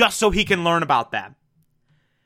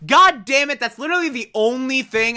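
exasperated outburst; 7 semitones higher, faster than usual. Real, not acted. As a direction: burst out in real irritation. Fuming at the misquoted line.

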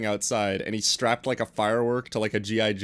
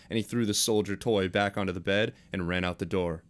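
ordinary talk, nothing marked

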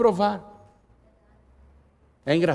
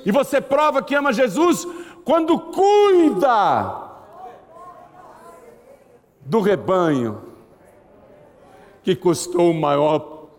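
preaching climax; 4 semitones higher, slower than usual. Acted.

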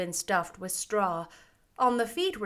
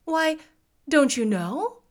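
higher, mildly surprised question; 6 semitones higher, 4 dB louder than usual. A narrator's reading. Girl surprised the scarecrow knows nothing of Oz, surprised. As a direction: ask with a touch of surprise. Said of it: "she returned in surprise".

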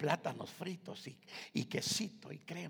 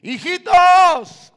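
long, high, loud drawn-out call; Jesus calling the disciples from the shore; shout from the shore; 24 dB louder, much slower than usual. Acted. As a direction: call across the water to the disciples. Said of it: "alguien gritó desde la orilla".

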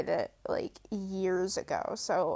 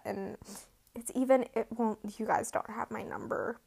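ordinary talk, nothing marked

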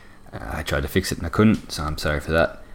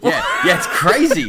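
excited shout; 10 semitones higher, 6 dB louder than usual. Real, not acted. Reacting to the video.

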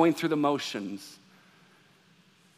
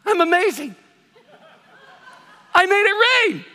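much higher and louder, laughing self-congratulation; mock boasting; 13 semitones higher, 6 dB louder than usual. Preacher mocking his own pride over the answered prayer, laughing and boastful. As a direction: crow like you personally made the rain, laughing at yourself. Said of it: "and I went".

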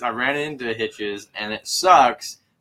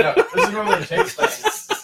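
laughing. Real, not acted. Laughing through the remark.

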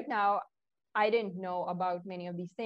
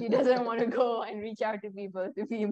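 laughing while talking; 4 dB louder than usual. Real, not acted. Laughing through the story.

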